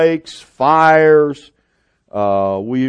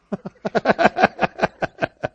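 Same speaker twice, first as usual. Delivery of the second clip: high, rapid chuckle; a real run of high chuckles; amused laugh; 8 semitones higher than usual. Real, not acted. Preacher after teasing Bev about her phone, amused laugh.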